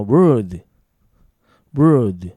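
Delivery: very loud, isolated "word" with no l; pronunciation of WORD. Shown as a demonstration. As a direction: punch out "word", curled r straight into d. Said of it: "そしてもう一個は".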